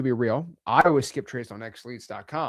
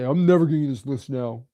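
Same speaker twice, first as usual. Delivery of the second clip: huffy clerk; 3 semitones lower than usual. Retold or imitated. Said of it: "get stick up their butt and say".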